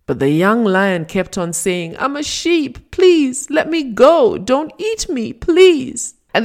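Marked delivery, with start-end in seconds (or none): 2.0-6.1 high, panicked pleading; desperate begging. A narrator's reading. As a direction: beg for your life. Terrified cub begs not to be eaten.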